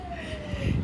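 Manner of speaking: high laugh. Real, not acted. Laughs at friends by pool.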